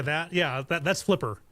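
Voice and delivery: ordinary delivery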